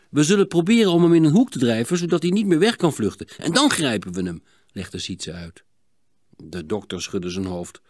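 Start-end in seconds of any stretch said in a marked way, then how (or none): 0.1-4.4 put-on a touch higher, quick eager boy; eager kid laying out the plan; eager boy explaining, lightly voiced. A narrator's reading.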